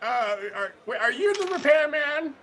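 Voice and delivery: high, puzzled granny voice. Retold or imitated. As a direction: innocent old granny at the door. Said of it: "do my best Granny impression".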